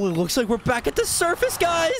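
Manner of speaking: ordinary talk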